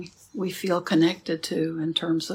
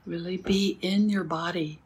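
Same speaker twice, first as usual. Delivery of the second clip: soft spa whisper; 7 dB quieter than usual. Acted. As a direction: ease them into stillness. Slow meditation cues.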